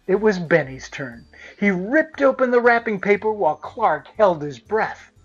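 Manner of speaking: ordinary delivery, nothing marked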